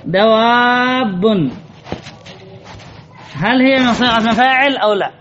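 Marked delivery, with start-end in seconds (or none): 0.1-1.2 دواب stretched very slowly, each sound held; drawn-out word. Shown as a demonstration. Teacher presenting the word to the class, stretched out slowly. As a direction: say دواب slowly so students catch it. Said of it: "هذه كلمة".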